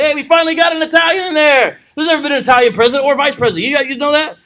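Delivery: cheering that one of us made it; mock celebration of a first Italian president; excited Italian-American. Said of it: "I'd probably be somewhat excited".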